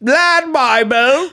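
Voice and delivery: "Lad Bible" in a slow, high, put-on British accent; mock British accent. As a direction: stretch "Lad" like a posh Brit. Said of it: "That's how they say it".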